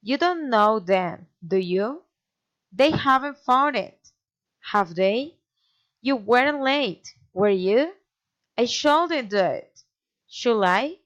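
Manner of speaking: statement falls, tag rises, as in DO YOU; pause, then swing up on WERE YOU; tag question intonation. Shown as a demonstration. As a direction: lift HAVE THEY after a falling statement. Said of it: "falling or raising intonation".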